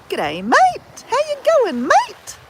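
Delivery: hammed-up Aussie g'day, voice shooting up high; broad Australian greeting. Shown as a demonstration. Showing viewers how Australians say hello, hammed-up high Aussie accent. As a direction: lay the Australian drawl on thick, swooping high. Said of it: "as we say in Australia".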